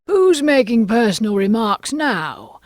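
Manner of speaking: loud, high, slow triumph; triumphant. A narrator's reading. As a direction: crow it out as the triumphant Hatter. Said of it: "the Hatter asked triumphantly".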